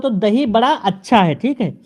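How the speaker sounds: ordinary talk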